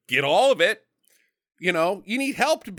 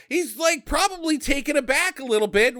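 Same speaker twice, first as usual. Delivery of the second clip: ordinary delivery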